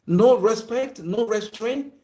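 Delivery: rising preaching. Real, not acted.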